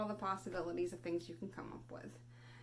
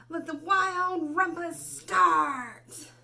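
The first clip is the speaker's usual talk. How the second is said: loud, slow, higher proclamation; triumphant cry; 8 semitones higher, 11 dB louder, much slower than usual. Acted. Max announcing the wild rumpus, loud slow cry. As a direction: shout it out like a king starting the party. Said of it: "cried Max".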